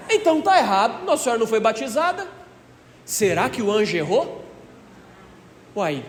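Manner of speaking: ordinary talk, nothing marked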